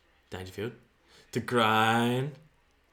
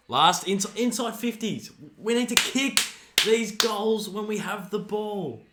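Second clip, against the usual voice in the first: frustrated urging; 6 semitones higher, 3 dB louder than usual. Real, not acted. Fan frustrated with team.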